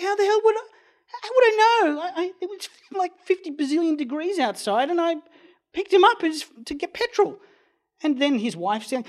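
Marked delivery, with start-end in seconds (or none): 0.0-7.4 much higher, louder exasperated protest; mock exasperated yell. Driver yelling back at the rifle-waving owner, exasperated mock shout. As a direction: throw your hands up and yell back. Narrator retells his own retort.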